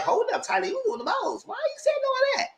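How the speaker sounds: high, squeaky imitation of the group; the group scolding Tyler in a high mock voice. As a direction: squeak it out like the whole crowd. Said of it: "everybody else was like".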